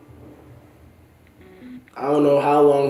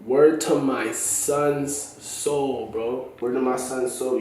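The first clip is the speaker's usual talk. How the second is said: sincere oath; 3 semitones lower, 7 dB quieter, much slower than usual. Real, not acted. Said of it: "y'all might think I'm lying".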